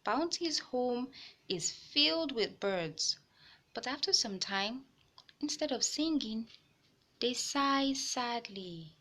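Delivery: put-on gentle read-aloud voice for kids; storybook reading for young listeners; story narration. A narrator's reading. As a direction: tell it like a picture book to children. Reading a picture book aloud.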